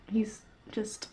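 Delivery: hushed whisper so the pet stays; real whisper. Real, not acted. Whispering to herself as the pet comes to join. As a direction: breathe the words with no voice. Pet joins, not to disturb.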